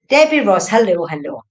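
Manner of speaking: loud call of the name, then hellos. Real, not acted. Medium summoning the spirit for Debbie, calling loudly. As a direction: call out loud to get attention.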